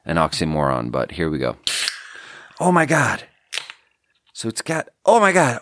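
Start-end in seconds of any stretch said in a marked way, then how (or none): 2.6-5.6 startled surprise. Real, not acted. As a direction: caught off guard as the can foams over. Soda fizzing over the can.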